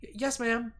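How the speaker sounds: high, stammered squeak; a cowed girl answering the secretary; mewling squeak. Acted. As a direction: squeak out a timid yes. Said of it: "my mewling squeak".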